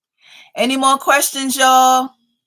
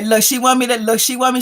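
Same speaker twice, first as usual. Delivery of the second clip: usual speaking voice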